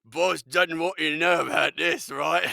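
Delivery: put-on booming, high drunk with a laugh; drunken goon. Drunk goon lets slip the boss's secret, loud laughing voice. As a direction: loudly spill a secret like a tipsy henchman. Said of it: "loose-lipped goon that you get drunk".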